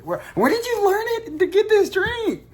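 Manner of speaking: high put-on voice, questioning; other person's voice. Someone asks about the drink.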